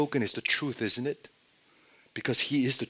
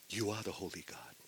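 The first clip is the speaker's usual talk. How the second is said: hushed reverence; 2 semitones lower, 16 dB quieter than usual. Acted. Peter confessing to Jesus in hushed reverence. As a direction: say it quietly, as if in awe.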